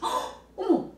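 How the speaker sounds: high, loud hammed-up startle with a gasp; pretend surprise. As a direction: play astonished to delight a child. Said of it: "막 이러면서 놀래주잖아요". (과장되게 깜짝 놀란 척)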